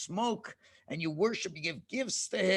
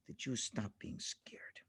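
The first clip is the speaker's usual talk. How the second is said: breathy, low whisper; 10 semitones lower, 16 dB quieter than usual. A narrator's reading. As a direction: let the calm settle in a whisper. Calm after the hush.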